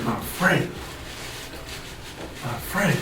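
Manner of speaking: soft, spaced-out repetition; pondering. Retold or imitated. Man thinking for an hour about the accusation, hushed puzzling. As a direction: act out racking your brain under your breath. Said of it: "So I'm thinking".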